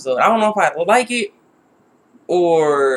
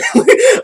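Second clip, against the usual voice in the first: high laugh; 6 dB louder than usual. Real, not acted. Laughs at the scene.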